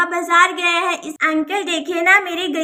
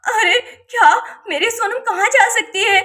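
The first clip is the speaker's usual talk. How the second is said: panicked cry; 3 semitones higher than usual. Acted. Mother hears child missing. (बच्ची खोने पर घबराई माँ की रोती आवाज़)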